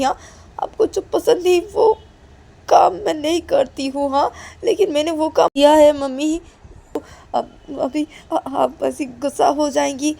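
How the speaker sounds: high, loud frantic pleading; desperate daughter. Acted. Daughter refusing to go to her mother, high frantic pleading. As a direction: plead for mercy, near panic. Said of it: "काजल बोलती".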